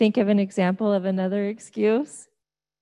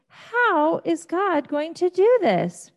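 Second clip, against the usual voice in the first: amazed; 9 semitones higher, 3 dB louder, slower than usual. Acted. Moses wondering aloud.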